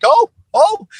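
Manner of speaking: a pastor caught on a slip, hammed-up gasp; mock embarrassed gasp. Pastor realizing his slip, mock embarrassed oh. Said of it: "And it's just like".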